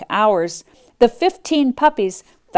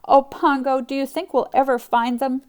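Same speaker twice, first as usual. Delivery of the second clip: sobbing; 7 semitones higher, 3 dB louder than usual. Acted.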